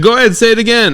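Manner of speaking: high-pitched mock goading; imitated heckler challenge. Heckler dares comedian to repeat the word, mock angry.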